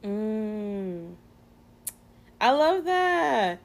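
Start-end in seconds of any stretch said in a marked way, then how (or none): 2.4-3.6 high, loud, drawn-out delight; delighted approval. Real, not acted. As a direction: sing out your approval, slow and high. Delighted by her friend's answer.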